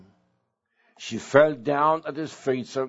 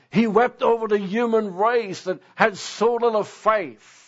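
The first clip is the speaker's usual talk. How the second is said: higher-pitched, building sermon refrain; impassioned preaching; 7 semitones higher than usual. Real, not acted. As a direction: build each repetition with rising fervour. Preacher builds on repeated phrase.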